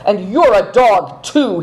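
loud, slow, rising rebuke; angry cry. A narrator's reading. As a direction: cry it at the horse. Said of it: "he cried to his roan steed".

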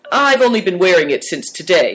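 put-on young character being offhand; casual. A narrator's reading. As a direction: toss off the answer in a boy's voice. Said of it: "said Big Tiger casually".

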